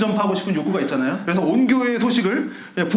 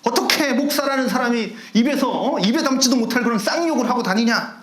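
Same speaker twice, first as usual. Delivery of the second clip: higher, outraged tirade; indignant rant; 5 semitones higher than usual. Retold or imitated. Church member rants about the cursing pastor, higher outraged tirade. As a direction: pour out the outrage in one breath. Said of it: "막 이렇게 하는 거죠".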